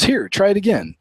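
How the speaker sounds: high, mocking imitation of a snarky guy. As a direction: sneer it like the condescending border helper. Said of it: "is real snarky".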